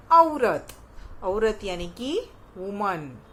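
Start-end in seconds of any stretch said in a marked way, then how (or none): 0.1-0.7 औरत called out high and loud; teacher's emphasis. Shown as a demonstration. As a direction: stress the औ of औरत. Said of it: "औ से क्या होता है". (औरत पर ज़ोर)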